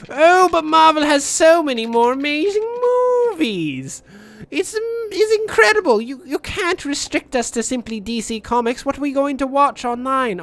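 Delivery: high-pitched panicked fanboy outburst; hammed-up fan freak-out, pitch leaping up. Diehard Marvel fans protest a DC-only rule, freaking out. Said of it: "they're freaking out because they're like".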